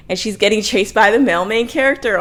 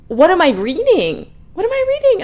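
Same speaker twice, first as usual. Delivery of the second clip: high squeal of disbelief; appalled disbelief; 12 semitones higher, 5 dB louder than usual. Real, not acted. Reviewer reacting to werewolf scent description, appalled. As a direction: repeat it higher, louder, incredulous.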